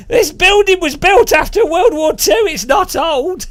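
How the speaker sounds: high, loud comic outrage; exasperated shout. Retold or imitated. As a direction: shout your mock protest at the guide. Acted outraged reply to tour guide.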